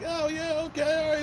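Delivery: high-pitched humoring reply; awkward politeness. Retold or imitated. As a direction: play along politely in a high voice. Acts out his polite, awkward reply.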